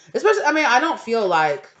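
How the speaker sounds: suddenly loud and high, pressing the point; loud insistent exclamation; emphatic outburst. Real, not acted. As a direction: burst out insisting.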